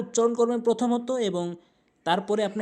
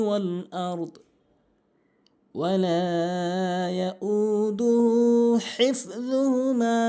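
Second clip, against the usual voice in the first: slow chanted tajweed recitation; Quran recitation; much slower than usual. Acted. Teacher recites the verse for students, slow melodic chant.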